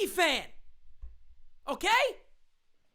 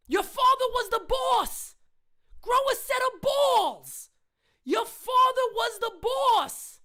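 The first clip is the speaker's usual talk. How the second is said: angry shout at the owner; 3 semitones higher, slower than usual. Real, not acted. Fan yelling at Hal about his father. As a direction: hammer each word like a furious fan.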